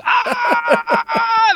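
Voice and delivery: a sudden high shrieking "Ah!" as if burned; mock scream. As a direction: shriek in hammed-up pain like hot filling just hit you. Molten filling hits, run screaming.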